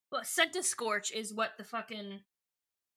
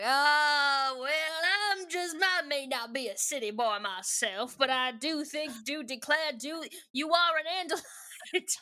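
Cajun drawl; 3 semitones higher than usual. Acted. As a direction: play him as a folksy Cajun fella, laughing through the end. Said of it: "he's a Cajun fella".

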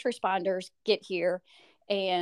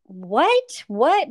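rising, louder "What? What?" in alarm; shocked disbelief; 7 semitones higher, 6 dB louder than usual. Retold or imitated. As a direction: ask in sudden panic, each one higher. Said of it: "And I'm like".

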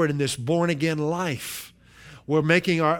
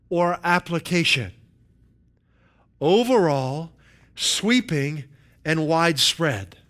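slow, spaced-out list with long pauses; dramatic definition reading; slower than usual. Acted. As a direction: let each phrase land before the next. Reads a definition item by item.

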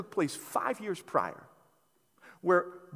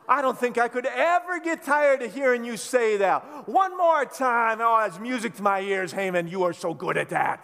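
gloating tease; 4 semitones higher, 6 dB louder than usual. Pretend. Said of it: "I'd be like".

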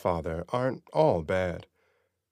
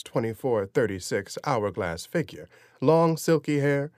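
usual speaking voice

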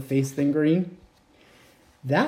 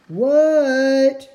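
high, louder drawn-out what; calling back; 9 semitones higher, 6 dB louder than usual. Acted. Froggy answering his mom, calling back loud. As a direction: shout back from the pond.

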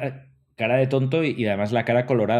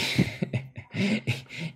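breathless laugh; 11 semitones higher, 4 dB quieter than usual. Real, not acted. Laughing at own blunder.

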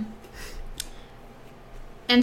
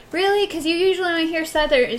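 higher, quick questioning voice; retold surprise, pitched up; imitated disbelief; 6 semitones higher, faster than usual. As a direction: play a puzzled stranger's doubt. Retold disbelief of curious strangers.

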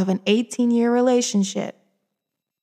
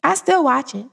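higher-pitched emphatic confession; emphatic admission; 5 semitones higher than usual. Real, not acted. Vlogger confessing love of a terrible film, emphatic. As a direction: admit it with a raised, falling pitch. Confesses, then repeats it quieter.

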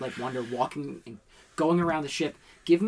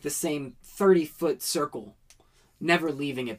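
normal talking voice

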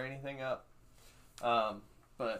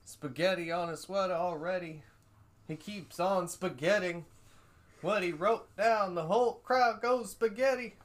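higher, louder hammed-up rap parody; comedian doing [name]; 7 semitones higher, 4 dB louder than usual. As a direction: ham up a spoof [name] rap. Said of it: "that comedian trying to impersonate, uh, [name]".

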